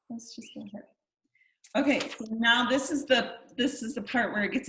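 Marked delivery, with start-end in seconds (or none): 0.1-0.8 breathy whisper to herself; whispered aside. Real, not acted. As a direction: whisper it under your breath. Mutters aside before next slide.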